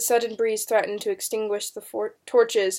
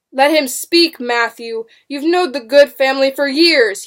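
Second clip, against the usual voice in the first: loud, raised, urgent appeal; cried appeal; 5 semitones higher, 10 dB louder than usual. A narrator's reading. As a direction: call out in his defence. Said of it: "he cried".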